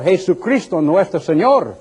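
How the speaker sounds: "Jesucristo nuestro Señor" in a Spanish accent; Spanish pronunciation. Shown as a demonstration. Preacher showing how a Spaniard names God. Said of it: "if they were speaking to a Spaniard".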